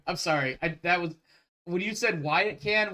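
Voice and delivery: ordinary talk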